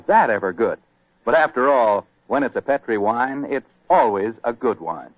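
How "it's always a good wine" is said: ordinary talk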